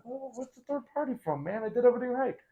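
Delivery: high-pitched imitated disbelief, voice jumping up; mock dismay. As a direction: play the stunned lover who did everything right. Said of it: "you're like".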